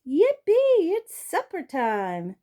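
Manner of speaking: high, loud burst of joy, then drawn out; a dog bursting with glee at supper; excited yelp. A narrator's reading. Dog hearing his dish fill, yelping with joy. Dog sees food poured in.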